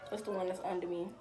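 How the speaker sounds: ordinary talk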